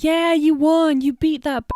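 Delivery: mock cheer.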